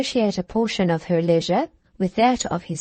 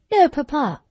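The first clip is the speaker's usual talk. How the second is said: higher, louder indignant protest; 6 semitones higher, 3 dB louder than usual. A narrator's reading. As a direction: cut him off, offended. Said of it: "interrupted she indignantly".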